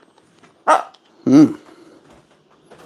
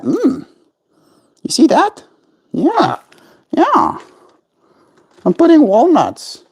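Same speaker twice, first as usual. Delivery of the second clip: very high cooing to the bird; high baby talk; 10 semitones higher than usual. Acted. Stuffing a walnut for pet bird Zoe, very high baby talk. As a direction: coo over the treat.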